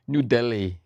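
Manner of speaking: light Indian-accented place name; a hint of exaggerated Indian accent; put-on Indian accent. Shown as a demonstration. Indian man mocking accented pronunciation, light Indian accent. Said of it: "things with the accent".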